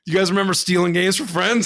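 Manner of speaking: usual speaking voice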